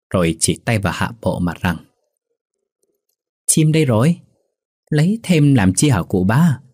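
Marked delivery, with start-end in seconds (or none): 3.5-6.6 put-on high, cheeky jeer at the old man; cheeky mockery. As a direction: tease him, pleased with the joke. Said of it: "Thằng bờm cười ha hả". (giọng trêu chọc láu lỉnh, cao giọng)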